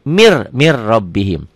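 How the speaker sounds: slow "mir rabbihim" with a doubled r; silent n. Shown as a demonstration. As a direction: drop the n and roll straight from "mir" into "rabbihim". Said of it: "huruf matinya jangan dibaca". ("mir rabbihim" pelan, r bertasydid tanpa n)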